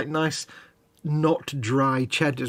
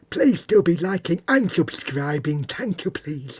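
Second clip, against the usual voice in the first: whispered YouTuber parody; 3 semitones higher, slower than usual. Pretend.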